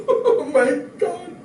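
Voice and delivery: high, slow laughing sigh; real laughter at the memory. Real, not acted. Laughs at own story.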